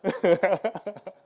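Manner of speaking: big high laugh imitating a friend; Matt's laugh. Pastor imitates Matt's laugh, loud and high. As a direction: burst out laughing as Matt. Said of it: "That's my Matt voice".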